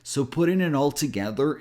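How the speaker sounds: ordinary talk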